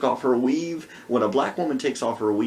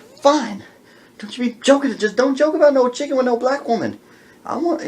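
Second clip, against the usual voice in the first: shrill-free high sassy woman, much higher and louder; angry high woman; 10 semitones higher, 5 dB louder than usual. Retold or imitated. Outraged wife refuses the blanket, high sassy voice. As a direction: play the outraged wife demanding real chicken, high and loud.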